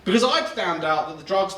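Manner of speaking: normal talking voice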